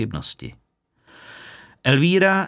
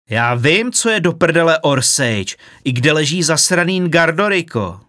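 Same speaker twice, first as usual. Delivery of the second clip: loud, higher furious outburst; angry shout; 6 semitones higher, 6 dB louder than usual. A narrator's reading. Filip shouts at Elvíra over the destination, furious. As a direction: shout it in exasperation. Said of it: "vykřikl".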